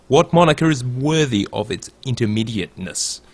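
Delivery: ordinary talk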